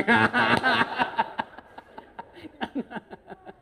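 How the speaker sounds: long, high laugh, fading out. Real, not acted. Speaker cracking up with a fellow husband, long laughter. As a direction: roar with laughter, then run out of steam.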